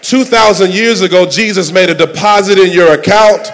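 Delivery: high, shouted preaching climax; full-force preaching. Real, not acted. Preacher urging congregation to receive, shouting at full force. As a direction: build to a roaring, high-pitched sermon peak.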